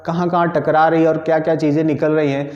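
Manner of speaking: ordinary talk, nothing marked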